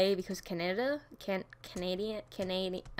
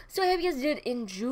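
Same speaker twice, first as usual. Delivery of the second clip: quick sign-off, then "enjoy" held very long; "enjoy" dragged into one long, silly note; 3 semitones higher, faster than usual. Pretend. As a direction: rush in and stretch out "enjoy" playfully. Sign-off with last word stretched out.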